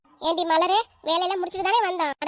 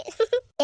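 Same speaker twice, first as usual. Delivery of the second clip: short higher-pitched giggle; 5 semitones higher than usual. Acted. Friend giggles remembering the mother-in-law's talk, short high giggle. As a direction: let a little laugh slip out. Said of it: "சிரிப்பு வந்துருச்சு".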